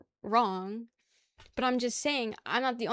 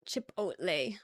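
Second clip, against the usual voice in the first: put-on Chipotle with a lilting Valley Girl rise; 4 semitones lower, slower than usual. Shown as a demonstration. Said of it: "that sounds like a Valley Girl".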